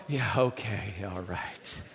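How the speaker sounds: deflated mumble; deflated resigned. Retold or imitated. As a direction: give up with a defeated mumble. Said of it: "I'm already going".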